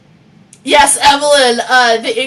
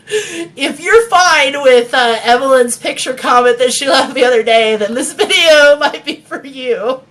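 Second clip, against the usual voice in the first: laughing; 4 semitones higher, 5 dB louder than usual. Real, not acted. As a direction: laugh through the words. Giggling through disclaimer.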